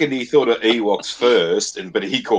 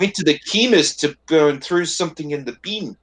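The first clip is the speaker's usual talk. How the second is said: higher mock Kiwi accent; lightly put-on Kiwi voice, higher; New Zealand accent; 8 semitones higher than usual. Mocking Kiwi speech to co-hosts, higher accent. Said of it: "New Zealanders like".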